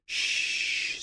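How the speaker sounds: a soft unvoiced shush; hushing whisper. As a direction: hush her gently. Ara hushing Bex.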